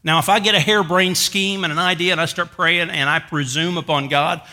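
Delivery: ordinary delivery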